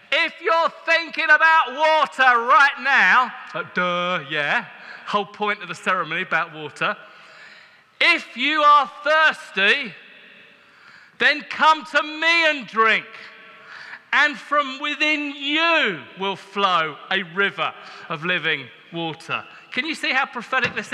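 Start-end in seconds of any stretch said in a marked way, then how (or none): 0.1-3.3 loud, high, slow proclamation; prophetic cry. Retold or imitated. Prophet interrupting the ceremony, loud slow proclamation. 8.0-19.4 loud, high, slow declaration; proclaimed aloud. Retold or imitated. Jesus calling out to the thirsty crowd, loud slow proclamation.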